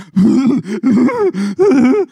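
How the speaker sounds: sudden loud high laugh; high burst of laughter. Real, not acted. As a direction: break into a big high laugh. Laughing at ugly cry memory.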